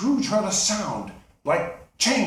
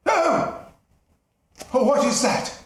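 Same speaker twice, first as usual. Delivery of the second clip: high, loud startled cry; startled old miser; 7 semitones higher, 4 dB louder than usual. Acted. Scrooge startled by Marley's face on the knocker, frightened cry. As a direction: jolt back in fright at the knocker.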